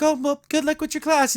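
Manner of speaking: high, sneering mock sweetness; snooty brushoff. Mean girl brushing off Willow, high mocking sneer. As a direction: toss it off like a mean girl leaving.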